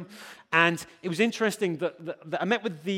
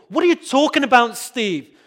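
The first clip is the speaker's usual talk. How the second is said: much higher and louder, an indignant heckle; mock indignant challenge; 6 semitones higher, 9 dB louder than usual. A congregant objecting to Steve, loud and indignant. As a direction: shout back at the preacher as if he's wrong. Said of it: "What happened to the Apostle Paul".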